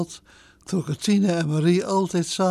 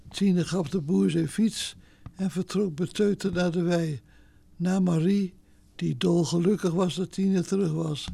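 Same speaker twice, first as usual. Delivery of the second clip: ordinary delivery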